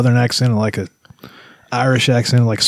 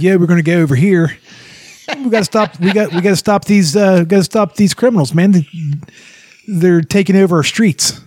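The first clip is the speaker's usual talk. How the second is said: high, loud mock Baltimore cop accent; imitating a Baltimore cop's accent, hammed up; 8 semitones higher, 3 dB louder than usual. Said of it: "Johnny Bernthal does a Baltimore accent".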